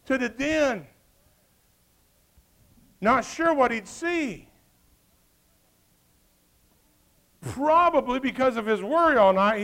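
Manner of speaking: fervent sermon storytelling; preaching. Acted. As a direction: drive the story home from the pulpit. Preaching the king's run.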